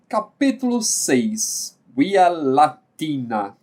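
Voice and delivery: slow, deliberate announcing of the chapter; slow title announcement. Acted. Teacher announcing the chapter title to students, slow and deliberate. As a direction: spell out the title like a heading.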